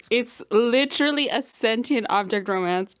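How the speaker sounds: usual speaking voice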